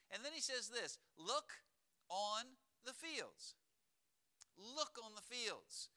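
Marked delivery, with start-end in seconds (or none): 1.2-5.9 slow, raised, weighty stress on LOOK; emphatic. Shown as a demonstration. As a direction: LOOK held and set apart, higher, slow. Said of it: "to put emphasis on it".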